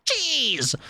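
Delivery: lightly voiced James May, stretching "Cheese!" out; James May saying cheese. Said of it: "James May saying cheese".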